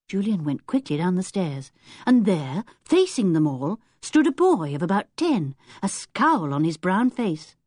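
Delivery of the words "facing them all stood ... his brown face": ordinary delivery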